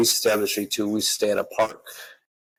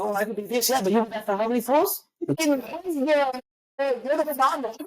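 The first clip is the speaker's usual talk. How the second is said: much higher, put-on girly voice, half laughing; mocking woman's voice. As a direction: mimic a flippant woman and crack up.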